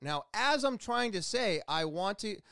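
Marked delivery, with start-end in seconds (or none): none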